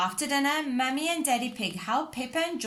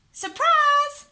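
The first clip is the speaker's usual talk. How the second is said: ordinary delivery, nothing marked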